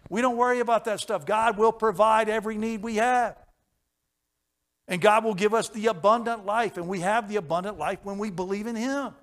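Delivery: loud, high, ringing preacher's cry; preaching at full force. Acted. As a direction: lift the room with it.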